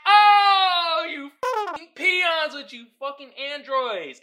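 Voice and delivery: high, loud hammed-up jeer; mock outraged outburst. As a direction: holler at them in mock scorn. Mock outburst at Android users.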